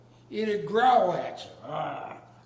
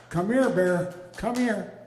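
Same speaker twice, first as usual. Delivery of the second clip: louder call across the lawn, rising on the second here; calling the dog; 2 semitones higher, 5 dB louder than usual. Retold or imitated.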